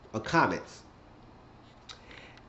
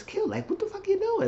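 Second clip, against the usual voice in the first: very high alarmed squeak; panicky squeak. Retold or imitated. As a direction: squeak it like she's scared of getting caught. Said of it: "Natasha said".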